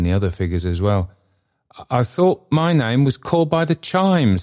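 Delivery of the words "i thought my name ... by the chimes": high-pitched, pleading character voice; supplicating Trotty. A narrator's reading. Trotty pleads with the goblin of the bell, supplicating. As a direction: plead upward in a high, humble voice. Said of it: "said Trotty, raising his hands in an attitude of supplication".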